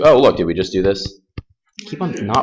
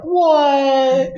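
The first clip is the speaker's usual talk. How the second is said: very high-pitched, drawn-out what; exaggerated astonishment, pitch shooting up; mock disbelief. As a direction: squeal it like a stunned student. Student's amazed reaction, retold.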